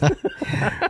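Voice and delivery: amused high laugh. Real, not acted. Amused by low waistbands.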